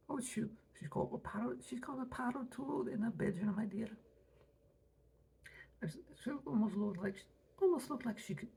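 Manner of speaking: high lady voice. Acted.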